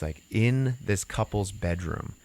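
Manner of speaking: usual speaking voice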